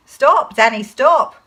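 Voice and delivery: loud, urgent command to halt; shouted warning. Acted. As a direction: shout to stop someone in danger. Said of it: "he shouted".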